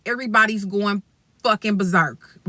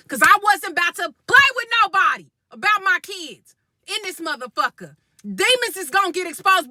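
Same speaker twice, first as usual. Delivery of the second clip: fired-up rant; 8 semitones higher than usual. Real, not acted. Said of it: "demons is being released".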